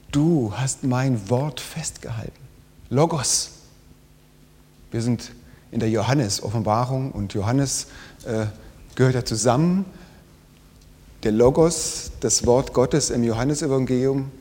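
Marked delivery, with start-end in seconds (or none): none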